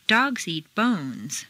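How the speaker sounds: slow, Dogs and bones punched, eat lighter; a slow teaching demo stressing Dogs and bones; stressing the nouns. Shown as a demonstration. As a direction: lean on Dogs and bones, let eat ride low. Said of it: "go through stressing only the nouns".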